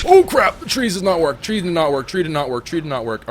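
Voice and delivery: loud high yelp that winds down over the repeats; panic, then deflate line by line; gamer's panicked yell fading to grumble; panicked shout, fading. Real, not acted. Panicked as the plan fails.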